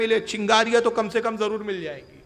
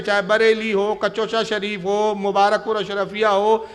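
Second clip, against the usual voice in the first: ordinary talk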